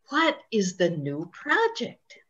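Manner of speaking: ordinary talk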